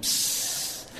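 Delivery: a breathy hiss like air leaving a balloon; deflating balloon hiss. Retold or imitated. Telling how Michelle's excitement deflated, balloon hiss. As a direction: let the air out of a balloon, pssst. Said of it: "like the balloon went".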